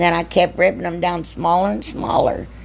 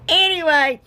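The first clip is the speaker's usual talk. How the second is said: high, still giggling; laughing; 9 semitones higher than usual. Real, not acted. Crafter getting back to the bow, laughing. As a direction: try to move on with laughter left in the voice. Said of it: "I can't stop laughing".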